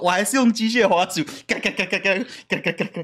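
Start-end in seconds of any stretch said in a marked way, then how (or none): 1.5-3.0 rapid clicking noise, mouth-made; mouse clicking. Retold or imitated. Husband imitates his noisy mouse for his wife, rapid clicking. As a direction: click it out fast like a gaming mouse.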